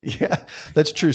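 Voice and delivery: usual speaking voice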